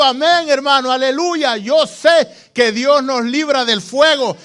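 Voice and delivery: loud, high church testimony, swelling to a shout. Acted. Three furnace survivors testify in church, shouting exultantly. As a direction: cry it out at full preaching force.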